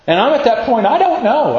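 high-pitched, helpless outburst; exclaimed uncertainty. Retold or imitated. Preacher admitting he does not know the outcome, exclaimed high. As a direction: throw it out high, at a loss.

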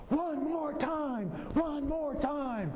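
high, drawn-out chant of riders begging; retold crowd yelling. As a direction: yell it like a whole coaster pleading. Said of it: "we all started yelling".

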